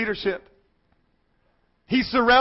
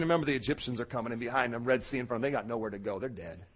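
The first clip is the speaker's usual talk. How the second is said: low, soft explanatory aside; hushed aside; 13 semitones lower, 14 dB quieter, faster than usual. Real, not acted. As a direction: drop the voice to set the danger. Hushed aside during the reading.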